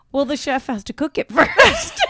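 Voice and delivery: laughing retold reply; amused punchline. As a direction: imitate the waiter while cracking up. Tells punchline, starting to laugh.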